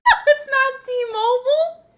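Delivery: squeaky high, laughing no; high laughing denial. Real, not acted. Streamer laughing off chat's T-Mobile joke, high and giggly. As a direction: protest through a laugh, up high.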